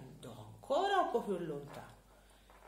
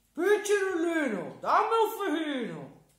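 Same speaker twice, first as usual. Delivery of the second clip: high, loud, pleading giant; a hungry storybook giant begging sweetly; 13 semitones higher, 12 dB louder than usual. Acted. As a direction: giant coaxing the boy for a fig. Giant asks boy for fig.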